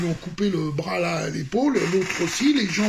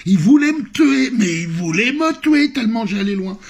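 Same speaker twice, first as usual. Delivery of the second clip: exalté; 4 semitones higher, 7 dB louder than usual. Real, not acted. Raconte la fureur du public.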